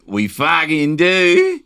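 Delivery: high, loud, drawn-out Australian; mock Australian accent. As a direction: holler it in exaggerated Australian. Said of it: "Like an Australian coming in".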